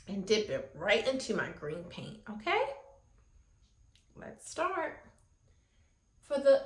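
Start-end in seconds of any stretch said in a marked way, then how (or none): none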